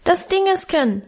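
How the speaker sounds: put-on high squeaky toddler voice; piping child voice. A narrator's reading. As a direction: peep it out like a tiny boy. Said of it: "piepste Hermann".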